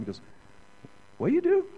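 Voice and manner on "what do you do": put-on very high, loud Japanese-accented question; imitated Japanese manager. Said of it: "asked me the third time".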